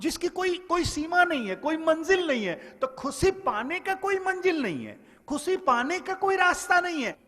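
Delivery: high, ringing sermon proclamation; fervent preacher's call; preaching at full force. Acted. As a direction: declaim it to the crowd, pitch held high.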